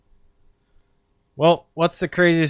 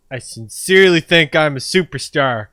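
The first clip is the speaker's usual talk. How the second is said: ordinary delivery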